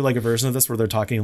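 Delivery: ordinary talk, nothing marked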